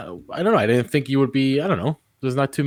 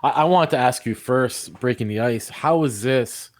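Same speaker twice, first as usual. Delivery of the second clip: ordinary talk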